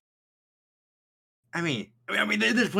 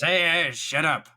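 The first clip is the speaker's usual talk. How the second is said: higher, louder snap; angry shout; 7 semitones higher, 6 dB louder than usual. Acted. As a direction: yell at them to shut up. Cyril snapping at Gwen.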